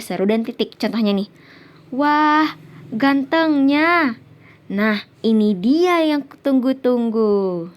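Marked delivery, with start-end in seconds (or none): 1.9-7.7 drawn-out admiring exclamation, pitched up at first; slow, acted-out delighted outburst; demonstrated exclamation. Shown as a demonstration. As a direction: exclaim with sudden strong feeling, stretching the words. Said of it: "mengungkapkan perasaan yang kuat atau mendadak".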